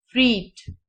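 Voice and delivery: फ्रीट said freet with a long ee; teacher re-models the odd word; careful word demo. Shown as a demonstration. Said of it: "इसमें long vowel".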